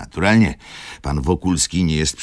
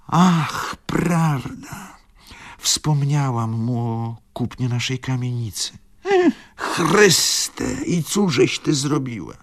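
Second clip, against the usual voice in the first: put-on higher fretful old man's voice; old father lamenting; 9 semitones higher, slower than usual. A narrator's reading. Tomasz laments to his daughter, higher and fretful. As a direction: wring your hands over the loss.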